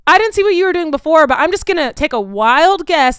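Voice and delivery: fast, high, loud indignation; indignant rant. Real, not acted. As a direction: fire it back at him, fast and loud. Ranting back at the classmate.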